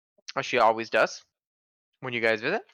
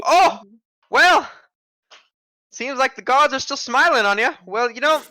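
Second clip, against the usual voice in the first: put-on high, louder old woman voice; a chatty old shopkeeper granny; old lady voice; 7 semitones higher, 4 dB louder than usual. Shopkeeper lady gives a tip.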